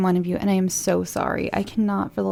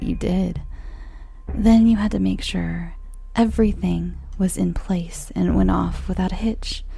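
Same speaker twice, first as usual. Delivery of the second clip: a dead woman mocking her killer, half-whispered; vengeful ghost. Ghostly revenge monologue begins.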